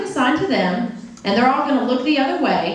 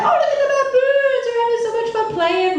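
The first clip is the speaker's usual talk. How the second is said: high, gushing zoo-visitor voice; delighted onlookers; 11 semitones higher than usual. Acted. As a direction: coo in wonder at cute animals. Group admiring monkeys at the zoo.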